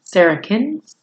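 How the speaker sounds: ordinary delivery, nothing marked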